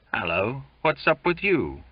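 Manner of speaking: mildly grumpy question. A narrator's reading. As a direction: ask with a touch of grumpiness. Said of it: "said someone grumpily".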